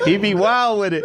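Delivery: high giggly laugh through words. Real, not acted. Joking with the interviewer about Orlando, laughing. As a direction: laugh while you say it.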